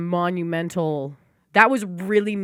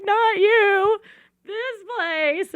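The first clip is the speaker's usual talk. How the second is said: imitating herself; much slower than usual. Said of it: "I'm like".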